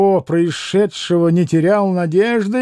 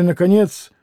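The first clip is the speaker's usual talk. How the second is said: plain reading voice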